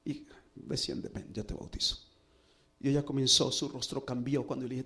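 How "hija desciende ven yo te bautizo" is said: tender whisper. Retold or imitated. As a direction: breathe the words to her gently. Pastor gently calls girl into river.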